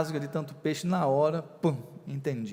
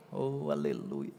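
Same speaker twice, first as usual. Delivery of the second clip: usual speaking voice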